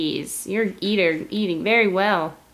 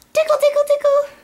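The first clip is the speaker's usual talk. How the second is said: much higher, louder playful squeal; giggly tickle attack; 5 dB louder, much faster than usual. Real, not acted. Tickling the baby's belly.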